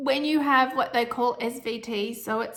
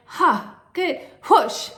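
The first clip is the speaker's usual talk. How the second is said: gasp and whoosh; 6 semitones higher, 7 dB louder, much slower than usual. Retold or imitated. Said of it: "I felt".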